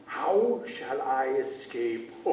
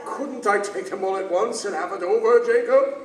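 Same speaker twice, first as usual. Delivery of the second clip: higher and louder, rising in panic; frantic plea; 6 semitones higher, 7 dB louder than usual. Acted. Scrooge pleading with Jacob's ghost, frantic high plea. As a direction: beg desperately to get it over with.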